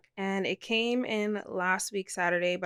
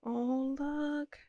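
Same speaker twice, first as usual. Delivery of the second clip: ordinary delivery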